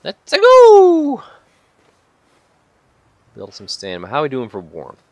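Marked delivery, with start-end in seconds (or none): 0.0-1.2 loud, high hype shout; excited yell. Real, not acted. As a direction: whoop it out, drawing out the end. Sudden hyped cheer while playing.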